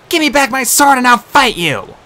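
high, loud, furious demand; a tough girl yelling to fight; defiant shout. A narrator's reading. Captive girl defying the monster.

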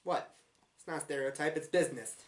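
a lightly put-on Brooklyn New Yorker voice, mock offended; a Brooklyn New Yorker lady taking mock offense, just a touch of the accent. Reviewer playing an offended Brooklyn native, lightly put-on New York accent. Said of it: "As the girl originally raised in Brooklyn".

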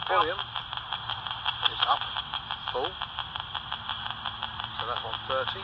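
slow breathy near-whisper; hushed. Real, not acted. Ghost hunter whispering settings beside the recorder. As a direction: keep it hushed so the recorder stays clean.

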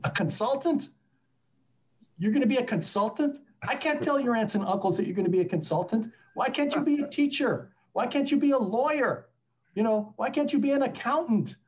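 high-pitched imitation of a dismayed mother; Greek mother. Greek mother hearing her son will be a consultant, dismayed. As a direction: protest in rising questions, mock maternal outrage. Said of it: "think of the Greek mother saying".

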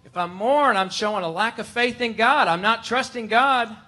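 mock the anxious self-reproach aloud; worried believer. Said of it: "But we think".

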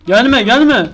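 scared yell. Real, not acted. Monster approaching.

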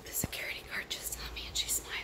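quick, hushed whisper. Real, not acted. Vlogger telling the camera about the guard, whispering. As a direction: whisper so nobody at work hears.

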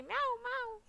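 two mewing cat noises; playful cat meow. Pretend. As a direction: meow like a cute little cat. Imitates a kitten meowing.

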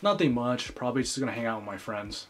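normal talking voice

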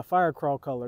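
ordinary delivery

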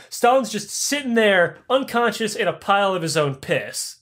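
ordinary delivery

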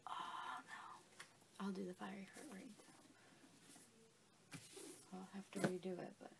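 whispered aside, voice nearly gone; hushed whisper. Real, not acted. To herself after forgetting the fiery heart, a hushed whisper. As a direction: breathe it under your breath like a slip-up.

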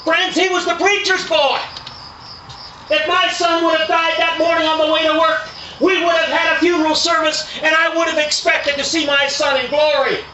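high, loud pulpit preaching; preaching at full force. Acted. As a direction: drive it home to the congregation. Preacher addresses the congregation.

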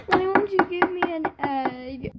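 whiny mock sob; hammed-up crybaby whine; fake crying. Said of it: "they cry about everything".

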